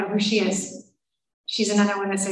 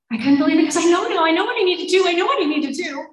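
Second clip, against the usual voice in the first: higher, louder, tumbling pleading; desperate protest; 7 semitones higher, 5 dB louder, faster than usual. Retold or imitated. Woman protesting to husband that she knows, desperate. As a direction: insist in a rush that you understand.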